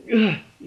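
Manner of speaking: loud, high exhausted sigh; tired sigh. Real, not acted. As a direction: sigh like you're worn out. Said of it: "I hate being so freaking tired".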